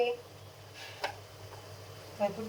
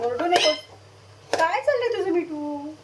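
higher, louder fond telling-off, quickening at the end; scolding the parrot; 7 semitones higher, 4 dB louder than usual. Real, not acted. Cook scolds her squawking parrot, higher and louder. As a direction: scold the bird sweetly but firmly.

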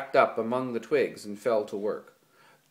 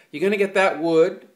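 loud, high demanding call; 7 semitones higher, 8 dB louder than usual. A narrator's reading. George to Lenny about the firewood, demanding. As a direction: George demands Lenny fetch wood, loudly. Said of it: "George demanded".

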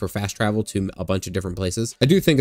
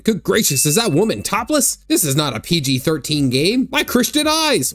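high-pitched, hammed-up shock; clutching-pearls mock outrage, squealing higher at the end; mock scandalized; 7 semitones higher than usual. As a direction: pretend to be appalled, pitch leaping up. Mock shock at topless character.

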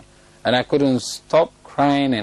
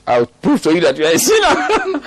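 laughing mock challenge; 3 semitones higher than usual. Acted challenge, breaking into laughter.

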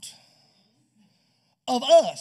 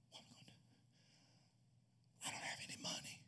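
hushed, breathless whisper; panicked whisper; 7 semitones lower, 21 dB quieter, much faster than usual. Acted. Groom's father whispering to his family in panic. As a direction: whisper so no guest overhears. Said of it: "the father of the groom whispering".

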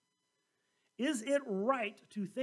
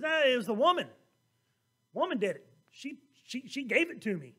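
louder, pointing the finger at her; blame-shifting protest; 6 dB louder than usual. Acted. Adam blaming the woman before God, loud and defensive. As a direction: push the blame off loudly. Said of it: "No, he goes".

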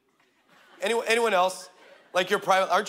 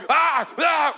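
loud, higher anguished cry; raging wail; 7 semitones higher, 5 dB louder than usual. Retold or imitated. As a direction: let the rage burst out. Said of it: "she went full-blown".

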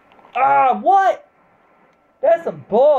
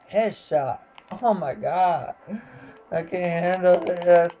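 ordinary delivery

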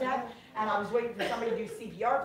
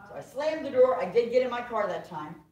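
normal talking voice

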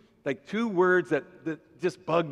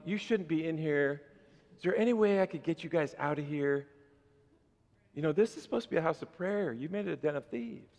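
much softer, meek and polite; mock timid Jesus; 5 dB quieter than usual. Pastor imagining a meek Jesus at the temple, soft. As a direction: ask nicely, barely pushing. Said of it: "do you think he said".

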